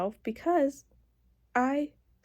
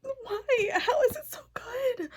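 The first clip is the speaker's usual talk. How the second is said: much higher, crying whisper; teary disbelief; 13 semitones higher, slower than usual. Real, not acted. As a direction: plead through happy tears. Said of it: "Why am I crying".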